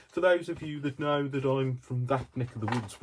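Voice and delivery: high, loud put-on Birmingham accent; playful Brummie accent. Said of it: "I'm saying it with a Birmingham accent".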